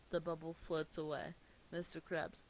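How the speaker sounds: normal talking voice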